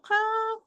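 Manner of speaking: HA drawn out very high, wrongly shaped. Shown as a demonstration. Teacher shows another wrong opening, high drawn-out HA. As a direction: pitch a mis-shaped HA way up. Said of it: "not ha".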